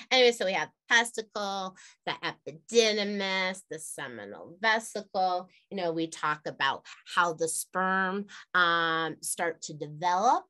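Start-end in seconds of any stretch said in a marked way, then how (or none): none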